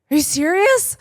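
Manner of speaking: high-pitched retold disbelief; can't-believe-it question, voice shooting high; incredulous. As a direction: act out stunned disbelief, pitch leaping up. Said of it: "I could not believe".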